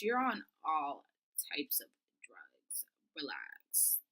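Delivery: slow, whispered exasperation; low private thought, barely voiced; unspoken aside. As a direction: whisper it under your breath, slowly. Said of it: "I obviously, I didn't say that".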